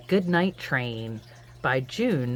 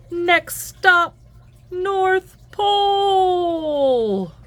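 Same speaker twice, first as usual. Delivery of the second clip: conductor yells; 13 semitones higher, 7 dB louder, much slower than usual. Acted. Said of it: "Conductor yells".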